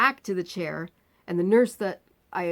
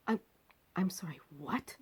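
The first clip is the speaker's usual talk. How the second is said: breathless, disbelieving whisper; stunned whisper; 9 dB quieter than usual. Retold or imitated. Stunned whisper to the surgeon about waiting till tomorrow. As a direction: barely voice it, stunned.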